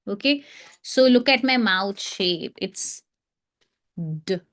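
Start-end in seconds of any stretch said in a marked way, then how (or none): 4.0-4.4 d stopped straight after the sound; softer, lower d cut off at once; short stop sound. Shown as a demonstration. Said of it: "Just stop after saying the sound".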